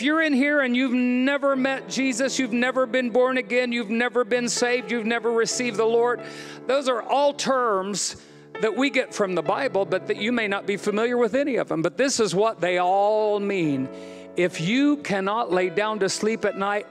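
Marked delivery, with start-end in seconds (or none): none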